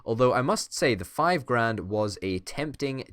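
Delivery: ordinary delivery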